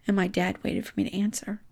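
ordinary delivery

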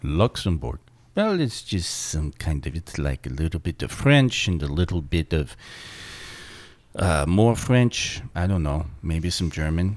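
low, soft attempt at a Luxembourg accent; lightly French-German Luxembourg accent. Shown as a demonstration. Fumbling through the requested Luxembourg accent. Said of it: "an accent from someone from Luxembourg".

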